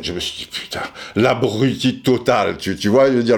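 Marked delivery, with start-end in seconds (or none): 0.0-0.8 hushed aside, much softer; breathy inner curse; whispered thought. Retold or imitated. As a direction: whisper it to yourself in dismay.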